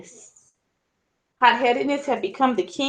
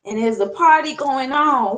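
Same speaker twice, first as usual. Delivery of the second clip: plain reading voice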